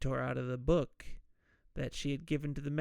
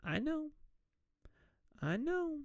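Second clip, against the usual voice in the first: high, soft coy voice; high coy Marian; 12 semitones higher, 6 dB quieter than usual. Retold or imitated. A woman admitting to her sweetheart that she knows, coyly. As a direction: answer like a knowing sweetheart. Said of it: "She's like".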